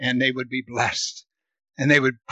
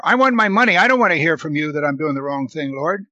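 the preacher voices a greedy nation brushing off God; voicing a greedy nation; 2 semitones higher, 7 dB louder, faster than usual. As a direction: play the selfish nation talking back to God.

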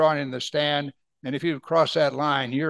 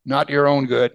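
ordinary talk

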